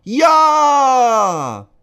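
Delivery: put-on high, loud kids' yes; children shouting yes; loud chorus. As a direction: shout it together like a class. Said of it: "Alle Kinder rufen laut".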